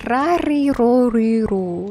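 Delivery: rolling R's practice, start loud and high then settle; rolled R drill. Shown as a demonstration. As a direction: trill each R hard like a practice drill. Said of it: "practice rolling R's".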